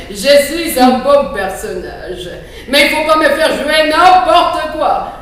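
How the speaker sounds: loud, slow booming wolf voice; big bad wolf. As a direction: boom it out like a puppet wolf. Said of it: "c'est le loup". (voix de grand méchant loup tonitruante)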